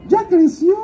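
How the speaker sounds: very high, gleeful-sounding godmother caricature. Retold or imitated. Godmother announcing the character's growth, in a very high put-on voice. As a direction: blurt the news in a high put-on voice. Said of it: "¿Qué, madrina?".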